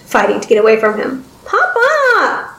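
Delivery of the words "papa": put-on very high childlike cry for help; high-pitched falling wail; shrieking girl. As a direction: call desperately for father. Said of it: "she shrieked".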